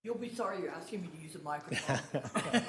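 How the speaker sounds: joking through a laugh. Real, not acted. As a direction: tease them with a chuckle. Joking about using the mic.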